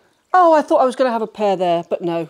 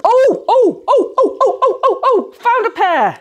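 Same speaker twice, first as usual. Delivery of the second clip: excited squeals; 9 semitones higher, slower than usual. Real, not acted. Finding a matching sock pair at last, excited squealing.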